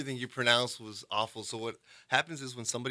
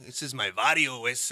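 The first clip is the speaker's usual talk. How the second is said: mock East L.A. Chicano accent, louder; hardcore East L.A. accent; 6 dB louder than usual. Shown as a demonstration. Demonstrating East L.A. talk for a Bostonian, Chicano accent. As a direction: claim the barrio in East L.A. slang. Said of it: "you know, maybe like that".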